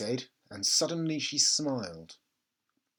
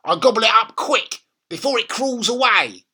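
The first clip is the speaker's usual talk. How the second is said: put-on much higher, louder old hag lecturing; grandma gloating about caterpillars; bossy grandma; 10 semitones higher, 10 dB louder than usual. A narrator's reading. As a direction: lay down the law, relishing it. Grandma relishing.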